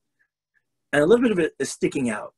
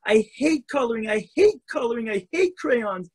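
higher, a hint of an upset little girl; upset child; 8 semitones higher than usual. Hurt girl tells her mother she hates coloring, high upset.

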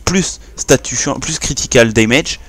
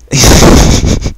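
loud, breathy burst of laughing; real loud wheezing laugh; burst of laughter; 9 dB louder than usual. Real, not acted. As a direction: crack up loudly, no voicing.

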